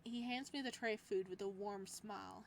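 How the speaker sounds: ordinary delivery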